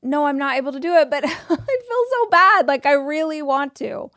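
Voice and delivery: fast, breathless refusal with a giggle; giggly people-pleaser backing down; nervous giggle. Shown as a demonstration. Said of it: "then you'll giggle after you say it".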